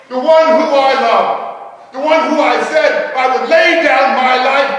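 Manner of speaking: slow, high, furious shout; shouted denial. Acted. Peter shouting his denial at the accusing crowd. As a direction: roar the denial at the crowd, drawing out each phrase. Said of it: "and I shouted".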